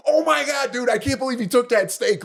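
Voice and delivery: high-pitched retold disbelief; worked-up, high exclamation of disbelief; incredulous outburst. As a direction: burst out high, reliving the outrage. Retold outburst once the guy left.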